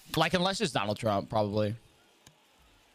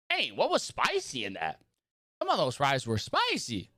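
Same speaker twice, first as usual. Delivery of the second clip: ordinary delivery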